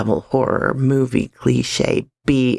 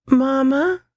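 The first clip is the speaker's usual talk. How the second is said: high, muffled voice calling from a hallway; muffled male voice calling; 11 semitones higher than usual. A narrator's reading. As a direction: call out muffled from behind a door. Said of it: "muffled male voice call out from the hallway".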